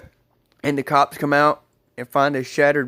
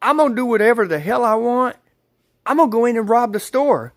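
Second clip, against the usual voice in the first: retold angry outburst, higher pitched; mad boyfriend; 7 semitones higher, 3 dB louder than usual. Storyteller acting out the boyfriend defying the cop, angry. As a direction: imitate a fed-up man's defiant rant, pitched up. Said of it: "That just made him mad".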